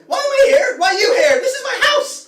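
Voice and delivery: high, loud outraged squawk; indignant old man. Acted. Hermit demanding why they're in his house, loud and high. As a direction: defend his home in a shrill huff. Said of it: "He goes".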